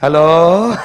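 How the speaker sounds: laughing, loud shout of hello. Real, not acted.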